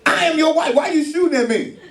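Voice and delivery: high, shrill-free startled wife yelling in mock alarm; wife's alarmed outcry. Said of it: "She like".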